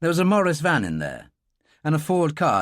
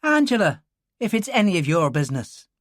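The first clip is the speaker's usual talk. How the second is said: put-on sharp, higher girl's retort; defiant Irish girl; 6 semitones higher than usual. Girl correcting the stranger, defiant Irish voice. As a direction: snap back the name, prickly.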